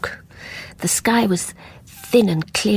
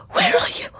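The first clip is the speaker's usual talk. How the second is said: whispering. Acted. Searching for the ghost.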